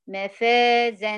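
"مفازًا" very slow, vowels stretched; wrong demo: very slow "مفازًا"; overlong vowel. Shown as a demonstration. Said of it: "ما تقوليش".

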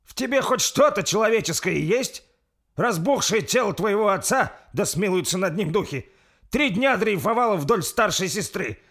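loud, high-pitched indignation; outraged accusation. Acted. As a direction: hurl the accusation at him. Гневное обвинение брата. (гневно, с возмущением)

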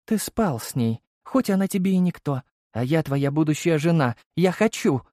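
high, indignant woman's voice; pleading high-pitched protest; jealous fiancée. A narrator's reading. Jealous fiancée confronts him.

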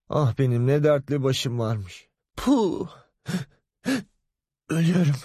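high, faint moaning lament; mother swooning after the pregnancy news. Acted. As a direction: sigh out as if collapsing. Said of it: "fenalaşıp olduğu yere yığıldı".

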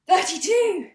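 Thirty-two! blurted high and loud; mock breathless shout. Mathematician bursting into the pub, shouting the number.

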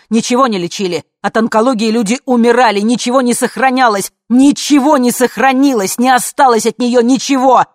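loud, rising exasperated rant; loud, insistent outburst; building angry shout. A narrator's reading. Man shouts over her, building.

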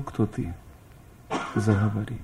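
ordinary delivery

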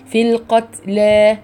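القتلى with just a faint breath on the ت, slow; light breathy t. Shown as a demonstration. Teacher giving the correct light whisper on ت. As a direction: barely release air on the ت. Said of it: "يا دوبك طالعة مع الحرف".

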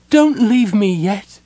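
high, loud, drawn-out tearful plea; sobbing. A narrator's reading. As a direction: beg in an acted wail. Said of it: "Laura sobbed".

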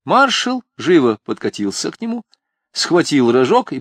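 plain reading voice

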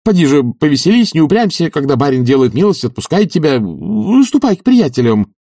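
high, loud urging; pressing, animated master; eager coaxing. A narrator's reading. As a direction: coax insistently, pitched well up.

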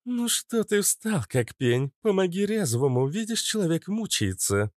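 high-pitched, smirking taunt. A narrator's reading. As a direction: tease from above in a high voice. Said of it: "усмехнулась Иштар". (с усмешкой, высоким голосом)

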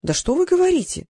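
high, gushing amazement; delighted admiration. A narrator's reading. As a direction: exclaim as if greatly impressed. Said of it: "восхитился Васап". (восхищённо, высоким голосом)

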